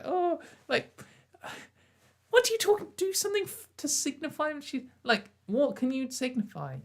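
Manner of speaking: incredulous high pitched rant; exasperated disbelief. Real, not acted.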